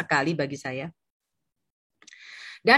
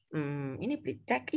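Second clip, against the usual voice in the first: low, soft inner voice; musing to oneself; 5 semitones lower, 10 dB quieter than usual. Retold or imitated. Agent silently noticing the cheque on the table, musing. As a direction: murmur it privately, pondering. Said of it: "Terus aku bilang".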